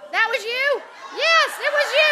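high, loud teasing call-out; playful accusing. Real, not acted. Teasing a woman in the crowd, high and loud. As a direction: point at the audience member and tease.

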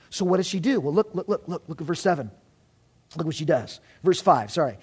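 ordinary talk

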